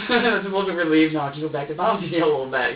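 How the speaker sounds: loud, laugh-broken retelling; laughing relief. Real, not acted. Suspect telling interviewer of his relief, laughing.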